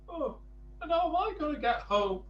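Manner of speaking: Mister Small stranded after his swim, high and fast; worried Mister Small. Acted. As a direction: rush it, high and anxious. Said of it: "he asked".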